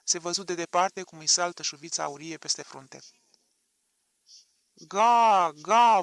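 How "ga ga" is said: loud high crowd roar. A narrator's reading. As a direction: bellow it like a whole crowd. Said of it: "vui mulțimea". (vuiet puternic de mulțime)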